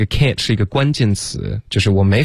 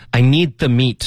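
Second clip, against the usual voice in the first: slow, clear model reading; measured, separated words; slow demo; 3 semitones higher than usual. Shown as a demonstration. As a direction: read it slowly for learners. Teacher models the line slowly.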